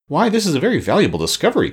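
excited, speedy and lifted in pitch; excited cry. A narrator's reading. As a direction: cry out at a discovery, quickly. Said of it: "cried the Scarecrow".

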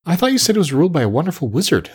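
hurried, confused objection. A narrator's reading. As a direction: object in bewilderment, rushing it out. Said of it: "seeming more and more confused".

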